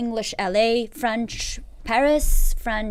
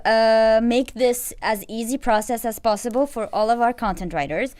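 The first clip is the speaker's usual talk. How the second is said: ordinary talk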